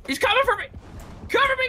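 high, frantic cry for help; panicked shout. Real, not acted. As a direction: scream for cover in panic. Enemy attacking, calls for cover.

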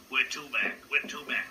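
rehearsing the cab driver's growl; practising the cabbie voice. Retold or imitated. He practises the cabbie's voice after leaving the cab, loud and gruff out of the side of his mouth. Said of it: "trying to learn to do this voice".

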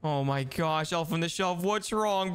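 normal talking voice

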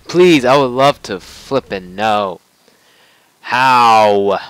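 loud, slow exasperated pleas; exasperated yelling. Real, not acted. Player facing two bosses at once, loud exasperated yell. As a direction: shout your frustration at the game.

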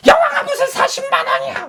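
high, loud shrieking shout with a laugh; furious wife shout. Retold or imitated. Wife shouting at husband over the bill, high laughing shout.

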